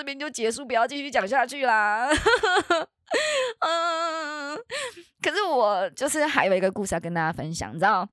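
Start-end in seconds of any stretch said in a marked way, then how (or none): 2.1-2.8 nervous laugh. Real, not acted. Nervous laugh after scary story.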